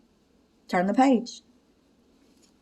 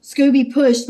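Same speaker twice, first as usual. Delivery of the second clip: ordinary delivery, nothing marked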